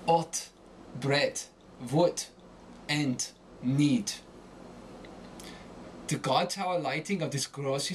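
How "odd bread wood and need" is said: "Ort, bread, wood, and need" ending in a hard T; final D as T. Shown as a demonstration. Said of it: "when you switch your D to T".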